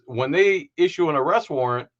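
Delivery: ordinary delivery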